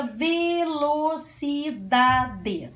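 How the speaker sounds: "velocidade" stretched into ve-lo-ci-da-de; syllable by syllable. Shown as a demonstration. Teacher introducing a long word to pupils, "velocidade" slowly sounded out. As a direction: draw out each beat of "velocidade".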